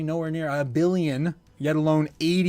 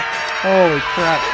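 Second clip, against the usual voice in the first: slow amazed exclamation; 8 dB louder, much slower than usual. Real, not acted. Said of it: "that put a smile on my face".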